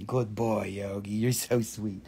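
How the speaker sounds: tender to dog. Real, not acted. Host coos softly to her dog Yogi. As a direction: coo at the dog gently.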